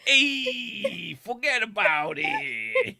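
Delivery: very high, loud, slow New York "forget about it"; New York accent. Retold or imitated. Street performer doing a New York accent for a woman, hammed-up and slow. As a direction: ham up a New York wiseguy. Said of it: "And he went".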